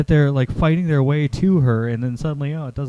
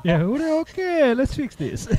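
very high, slow put-on voice; mock reconciliation; 13 semitones higher, slower than usual. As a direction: squeak it out slowly, playing peacemaker. Plays along as reconciled character.

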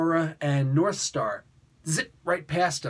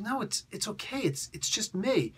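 higher and softer, coaxing; gentle, soft concern; 7 semitones higher, 4 dB quieter than usual. Retold or imitated. Puck softly checks on a shaken Marina, high and gentle. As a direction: ease up on her softly like a worried friend.

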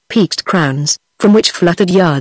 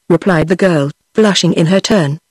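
ordinary delivery, nothing marked

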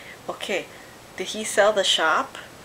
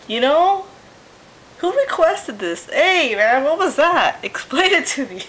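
outraged disbelief; 6 semitones higher, 6 dB louder than usual. Real, not acted. Outraged reaction to video.

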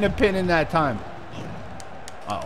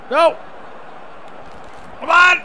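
frustrated shout; 10 semitones higher than usual. Real, not acted. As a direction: yell it at the screen. Frustrated gamer yelling at game.

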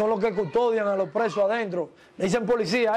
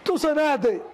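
higher-pitched question as the gringo; lightly voiced boss, pitched up; imitated gringo; 5 semitones higher, slower than usual. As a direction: ask it high, in his voice.

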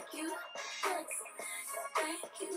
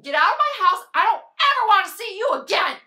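full-force, high screaming; furious shout; 3 semitones higher, 16 dB louder than usual. Acted. Throwing out the friend who betrayed her, furious shout. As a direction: scream them out of the house.

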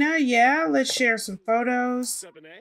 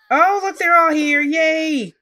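high, loud delighted squeal; excited; 7 semitones higher, 8 dB louder, slower than usual. Real, not acted. Player thrilled everyone arrived, loud happy squeal. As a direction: cheer as the whole family shows up. Sims all arrive together.

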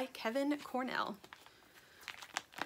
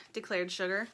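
plain reading voice

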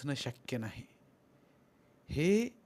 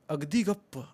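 ordinary delivery

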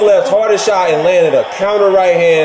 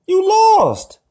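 high taunt. Real, not acted. Taunting beaten Kovalev.

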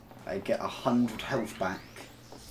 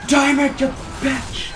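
angry shout; 14 semitones higher, 10 dB louder, much slower than usual. Real, not acted. Frustrated shout at enemy in game.

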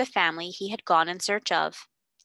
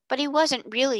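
hasty; 6 semitones higher than usual. Acted. Girl hastily defending the lost girl's father, blurting high. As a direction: rush in to defend him. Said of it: "put in Janet hastily".

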